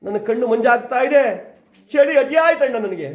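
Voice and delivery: dying plea. Acted. Dying Bhima pleads to brother.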